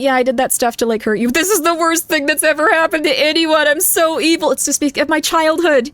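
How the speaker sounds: mock narcissist. Streamer mocks a narcissist to a partner, whiny poor-me voice. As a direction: overplay the poor-me victim, fishing for comfort. Mocking a self-pitying narcissist.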